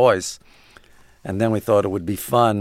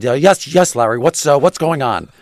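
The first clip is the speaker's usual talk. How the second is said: louder, higher blustery boss on the phone; Steinbrenner; 4 semitones higher, 5 dB louder than usual. Retold or imitated. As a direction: bark it out like a booming team owner. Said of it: "And he said".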